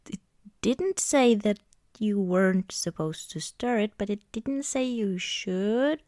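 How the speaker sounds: normal talking voice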